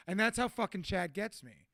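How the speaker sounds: ordinary delivery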